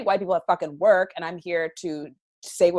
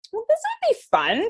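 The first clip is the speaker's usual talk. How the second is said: high, sing-song mock enthusiasm; mock cheerful. Mocking their own imagined eagerness, sarcastic chirp. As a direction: play it as sarcastic glee. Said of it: "we woke up one morning and we're like".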